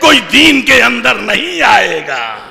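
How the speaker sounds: put-on young man shouting defiance at Hajjaj; defiant challenge. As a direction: hurl it at the tyrant Hajjaj like a challenge. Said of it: "للکار کے یہ علی کا شیعہ کہہ رہا ہے".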